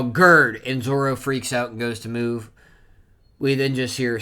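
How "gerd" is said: loud mock shout of a name; retold yell. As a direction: call it out loudly, imitating alarm. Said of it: "see Hyrudine yell".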